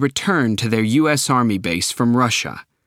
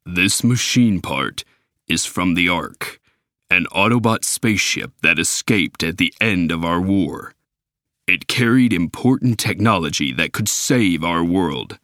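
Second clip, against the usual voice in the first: robot leader; 5 semitones lower than usual. Acted. Optimus explaining the Ark to the humans, lower and grave.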